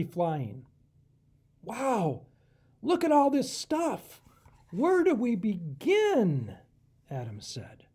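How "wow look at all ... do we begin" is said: higher, louder awestruck cry, slowing at the end; amazed raccoon. Acted. Adam amazed at the treasure crate, excited. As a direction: gasp at all the wonderful stuff.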